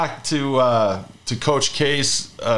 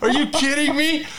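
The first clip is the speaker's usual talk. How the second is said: mock disbelief; 13 semitones higher than usual. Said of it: "I was like".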